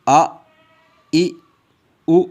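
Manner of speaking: आ ई उ each said alone, clean and short; teacher sounding आ ई उ apart for students; isolated vowel sounds. Shown as a demonstration. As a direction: sound out आ, ई, उ one by one without jerk. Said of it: "बग़ैर जोड़ों के इसकी आवाज़ sound निकालेंगे".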